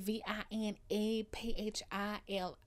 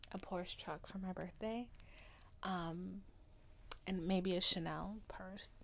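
whisper; 2 semitones lower, 9 dB quieter than usual. Real, not acted. Whispered wish for gifts.

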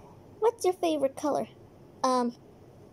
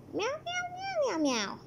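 high soft kitty meows; cat meows; 13 semitones higher, 5 dB quieter than usual. Acted. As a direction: meow like a little cat. Playing the cat.

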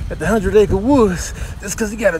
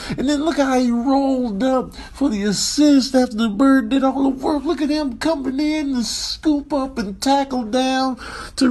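outraged commentator; 6 semitones higher than usual. Acted. Outraged commentary on hunting bird.

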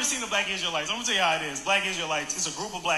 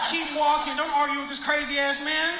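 high-pitched pleading exasperation; exasperated urging; 8 semitones higher than usual. Retold or imitated. Man warning his girlfriend off the preacher, exasperated. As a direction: urge her away before trouble starts.

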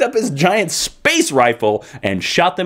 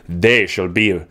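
imitating Magneto; 7 semitones lower, slower than usual. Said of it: "he's like".

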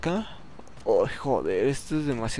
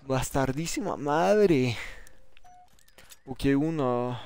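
usual speaking voice

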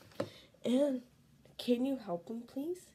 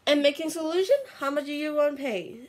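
shout; 3 semitones higher, 11 dB louder, faster than usual. Real, not acted. Shouted price haggling.

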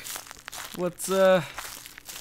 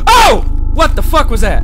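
startled shout; 7 semitones higher, 8 dB louder than usual. Real, not acted. Sudden scare in game.

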